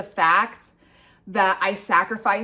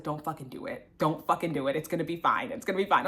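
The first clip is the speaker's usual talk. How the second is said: self-talk; 5 semitones lower, 7 dB quieter, much faster than usual. Retold or imitated. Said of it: "but I was like".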